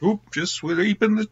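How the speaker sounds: high goofy mock Adam Sandler voice; Adam Sandler impression. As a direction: squeak it out like a bad Adam Sandler. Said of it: "that's a horrible Adam Sandler impression".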